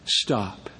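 low, soft Stop, the word Stop dropped down and hushed; quiet command. Retold or imitated. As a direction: say Stop softly with calm authority. Jesus quietly says stop again.

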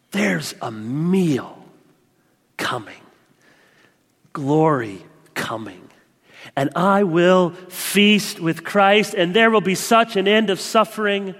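slow, stretched, full-voiced proclamation; slow fervent preaching. Preacher proclaiming the end of sin to his church, slow and fervent. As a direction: linger on each word of the promise.

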